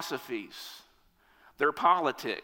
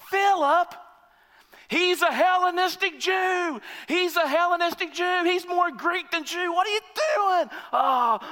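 boo the pick then sink into disbelief; a furious fan booing the choice of Philip; outraged heckler; 9 semitones higher than usual. Retold or imitated.

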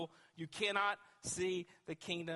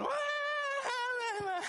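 high, loud wailing cry like a bawling infant; mock baby wail; 5 dB louder than usual. Preacher mimics adults crying to leave church, loud high mock wail. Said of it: "we'll have a bunch of adults going".

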